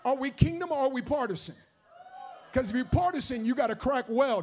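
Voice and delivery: loud, high preaching; full-force preaching. Acted. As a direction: press the congregation hard. Preaching to congregation.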